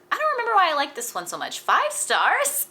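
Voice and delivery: shocked at her own five stars; incredulous outburst. Real, not acted. Disbelief at her own rating.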